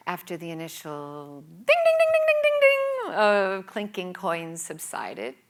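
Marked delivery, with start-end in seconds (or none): 1.7-3.1 high, loud, sing-song coin dings; ringing dings. Retold or imitated. Imitating coins dropping into the charity mug, sing-song. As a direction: ring out each ding like a coin hitting the mug.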